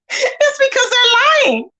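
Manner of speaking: laughing through the words, slow and high; giggling punchline. Real, not acted. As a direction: crack up while saying it. Laughing at liars.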